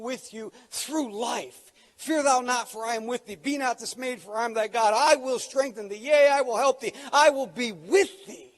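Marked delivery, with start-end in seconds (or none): 2.0-8.5 loud, high, building recitation; preacher declaiming Isaiah to the church; proclaimed scripture. Acted. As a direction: thunder the promise over them.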